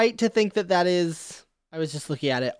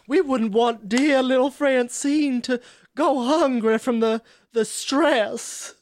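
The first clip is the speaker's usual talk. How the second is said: put-on southern belle accent. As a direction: coax the sheriff like a southern belle. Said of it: "he is like a southern belle".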